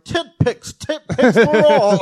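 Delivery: high, slow miserly giver; Scrooge voice. Retold or imitated. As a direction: dole it out like Scrooge. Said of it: "She's like Ebenezer Scrooge".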